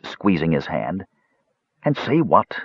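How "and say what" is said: higher, understated desperate question; a touch of desperation, kept contained; quiet frustration. A narrator's reading. Mage answers the weaver's plea, quiet frustration. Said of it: "quiet yet desperate frustration".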